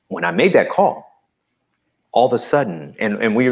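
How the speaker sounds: ordinary talk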